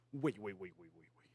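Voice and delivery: hushed, low "wait, wait, wait"; little kid's murmured stalling, imitated; imitating daughter's habit. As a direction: repeat it under your breath, fading away. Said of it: "mi hija tiene una costumbre que hace".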